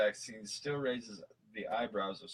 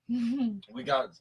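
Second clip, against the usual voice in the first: normal talking voice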